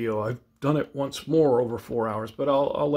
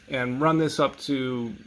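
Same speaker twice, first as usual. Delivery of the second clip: usual speaking voice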